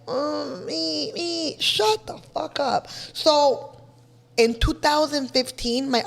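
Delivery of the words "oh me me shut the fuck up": mock crybaby.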